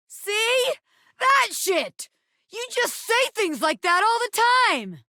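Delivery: high, heated outburst; furious high-pitched rant; angry yelling. Acted. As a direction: yell it at the rival. Character yelling at rival.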